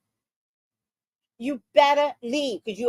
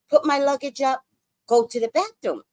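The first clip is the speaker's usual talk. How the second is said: ordinary delivery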